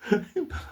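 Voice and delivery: short high laugh breaking into the story; high chuckle. Real, not acted. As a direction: laugh at your own tale.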